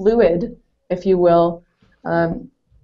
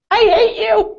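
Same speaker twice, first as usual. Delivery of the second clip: much higher, louder petulant mock snap; a sulky kid's hammed-up retort; mock angry outburst; 14 semitones higher, 4 dB louder than usual. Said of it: "I was so mad".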